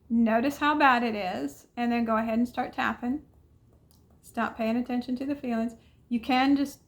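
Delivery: ordinary talk, nothing marked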